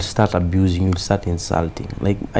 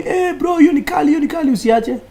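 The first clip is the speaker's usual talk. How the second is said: high-pitched imitation of cheering admirers; excited fans; 5 dB louder than usual. Fans calling out praise to the comedian, mock-excited high voice. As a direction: hype him up in a raised, high voice. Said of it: "they call me".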